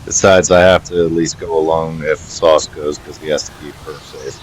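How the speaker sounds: low character voice. Acted. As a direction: speak as the protective companion.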